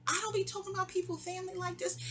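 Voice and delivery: fast, high and defensive. Retold or imitated. On the phone defending herself to the caller, fast and high. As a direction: rush it out, protesting, pitch up. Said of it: "I'm on defense".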